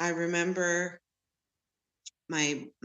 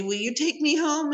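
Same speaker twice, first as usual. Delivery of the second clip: put-on child asking; 10 semitones higher, 5 dB louder than usual. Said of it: "I'd say".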